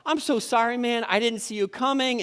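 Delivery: high-pitched apology; apologetic calling across. Retold or imitated. Driver apologizing to an angry man in another car, high-pitched call. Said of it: "trying to communicate from my car to his car".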